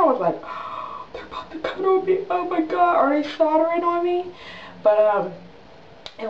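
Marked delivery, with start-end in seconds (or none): 0.4-1.1 sharp whispered gasp of mock fright; acted gasp. Acts fright at hearing the blade. 1.1-4.3 high, breathless retold panic starting in a whisper; mock panic. Acts panic on operating table.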